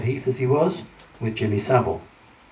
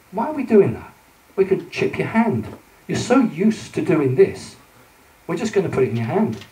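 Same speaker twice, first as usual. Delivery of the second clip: mock authorities; 7 semitones higher, 3 dB louder than usual. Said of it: "they'll say".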